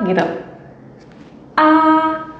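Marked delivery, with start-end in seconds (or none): 1.5-2.3 "Ah" held high and level; first tone. Shown as a demonstration. Teacher showing learners the tone, "Ah" high and level. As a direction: sustain "Ah" on one high note. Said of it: "yang penting dia datar".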